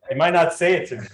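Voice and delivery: ordinary talk, nothing marked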